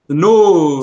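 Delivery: North stretched loud and long on the O; elongated vowel. Shown as a demonstration. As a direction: belt out North with a huge drawn-out O. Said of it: "and then just have the accent".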